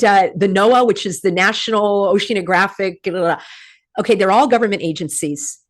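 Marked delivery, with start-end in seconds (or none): none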